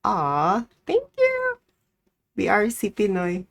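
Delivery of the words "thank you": high sweet thank-you; chirpy thanks. Real, not acted. Cashier thanking a customer, high and sweet. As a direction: lift it up for the customer.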